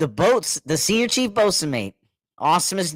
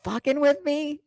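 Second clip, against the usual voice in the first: retold higher-pitched disbelief; incredulous challenge; 10 semitones higher than usual. Officer to corpsman who misread his joke, incredulous. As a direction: challenge him, half-disbelieving. Officer suspects he is being teased.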